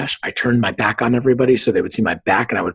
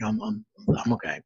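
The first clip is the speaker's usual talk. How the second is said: sheepish reassurance; 5 semitones lower, 9 dB quieter than usual. Retold or imitated. Downplaying crash to his wife.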